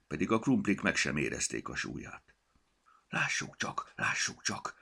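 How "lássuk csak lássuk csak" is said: voiceless muttering to himself; a goblin rummaging and muttering; muttered. A narrator's reading. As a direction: mutter it half to yourself.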